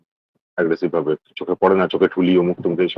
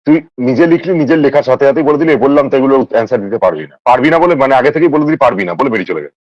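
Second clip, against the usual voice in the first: raised, louder accusing tone; indignant; 6 semitones higher, 4 dB louder than usual. Real, not acted. Accusing a friend of posting and then walking off, indignant. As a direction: confront them in a higher, heated voice.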